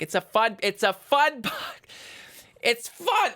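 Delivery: high-pitched mock insistence; mock protest. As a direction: protest to mom with comic high intensity. Mock protest to mother about yelling.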